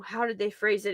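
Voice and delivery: ordinary talk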